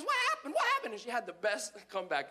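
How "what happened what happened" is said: very high mimicking mom voice; high-pitched, repeated mock question; mocking mother. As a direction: throw his question back as the mother. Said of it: "She goes".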